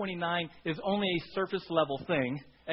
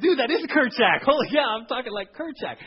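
loud, higher burst of recognition; excited self-discovery, pitched up and loud; excited realization; 6 semitones higher, 10 dB louder than usual. Retold or imitated. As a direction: blurt it out amazed.

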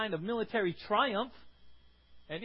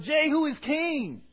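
shouted acclaim; 5 semitones higher, 5 dB louder, slower than usual. Retold or imitated. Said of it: "blew the trumpet and shouted".

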